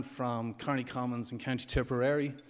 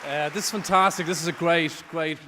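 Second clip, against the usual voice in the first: excited; 5 semitones higher, 11 dB louder than usual. Real, not acted. Winner reaches the stage.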